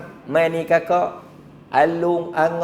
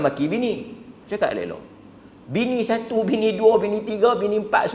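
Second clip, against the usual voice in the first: usual speaking voice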